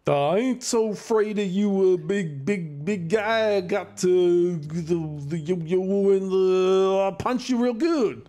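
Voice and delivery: lightly voiced high goon boast; tough-guy taunt. Big yo-yo goon taunts the party, lightly voiced bruiser. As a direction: brag like a dim bruiser.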